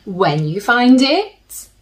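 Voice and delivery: gentle storybook reading with slow pauses; soothing storybook narration for little listeners; reading to children. A narrator's reading. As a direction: read it like a bedtime picture book. Reads picture book to children.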